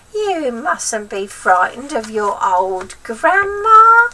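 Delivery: slow, loud, sugary crone voice; icy coaxing. A narrator's reading. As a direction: coax him slowly, icy sweet. Grandma coaxing, icy smile.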